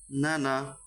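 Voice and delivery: নানা said short, without dragging either vowel; short quick vowels. Shown as a demonstration. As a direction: keep both syllables of নানা brief. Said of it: "আমি উচ্চারণগুলো তাড়াতাড়ি করেছি".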